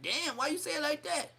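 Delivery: high, put-on whiny voice. Dazed Crip [name] asking his homies, high put-on whine. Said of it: "It was like".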